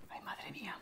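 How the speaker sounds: breathless anxious whisper. Real, not acted. Fan fretting over elimination, whispered. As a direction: whisper it under your breath.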